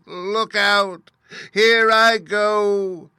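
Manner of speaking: very high, loud, drawn-out witch wail; dying witch. A narrator's reading. Melting witch wailing her last words at Dorothy. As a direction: melt away shrieking to the end.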